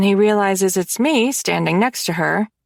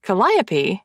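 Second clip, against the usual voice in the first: ordinary delivery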